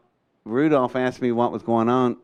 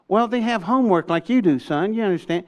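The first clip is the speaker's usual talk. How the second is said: high, patronizing mock-adult voice; imitating a condescending grown-up, pitched up; 7 semitones higher than usual. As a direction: talk down to a teenager. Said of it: "it's very condescending".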